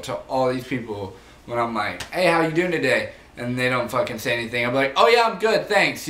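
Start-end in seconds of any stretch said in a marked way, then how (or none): 2.0-3.1 loud fake cheer. Greeting rude customers. 5.0-6.1 sarcastic answer. Retold or imitated. Mocking silent customers.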